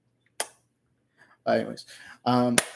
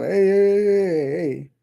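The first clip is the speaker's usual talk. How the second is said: mock celebration; 6 semitones higher, 5 dB louder, slower than usual. Mock cheer at rigged World Cup.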